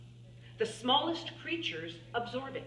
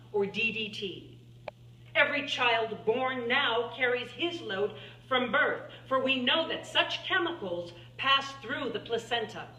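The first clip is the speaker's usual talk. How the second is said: normal talking voice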